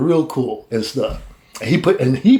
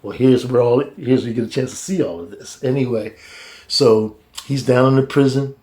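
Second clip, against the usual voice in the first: normal talking voice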